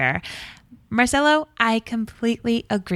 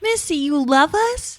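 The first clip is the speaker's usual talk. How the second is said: gushing; 8 semitones higher, slower than usual. Real, not acted. Hosts gush over voicemail.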